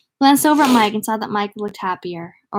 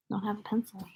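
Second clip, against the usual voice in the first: quick hushed aside; whispered aside; 12 dB quieter than usual. Real, not acted. Reader breaks off from the book with a whispered aside. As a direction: whisper it softly off the story. Aside while reading aloud.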